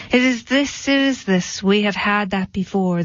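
plain reading voice

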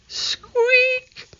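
very high, thin mouse squeak. A narrator's reading. A frightened pet mouse in Annie's pocket, squeaking. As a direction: squeak the word like a tiny mouse. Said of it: "the little mouse in her pocket".

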